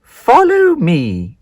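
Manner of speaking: high, slow, grand godmother voice; high spider godmother. Acted. Hairy godmother introducing herself to Spinderella, slow and grand. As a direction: announce yourself slowly like a magical godmother.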